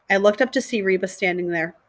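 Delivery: ordinary delivery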